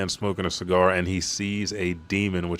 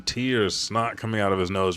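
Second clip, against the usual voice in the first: usual speaking voice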